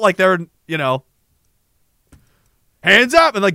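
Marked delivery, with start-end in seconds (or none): 2.8-3.3 high, loud soldier's order; imitated shouted command. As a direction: bark it like a soldier.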